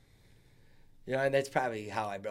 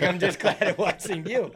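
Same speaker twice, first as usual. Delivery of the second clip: kind relieved reassurance; 6 semitones higher, 7 dB louder than usual. Retold or imitated. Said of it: "It's like".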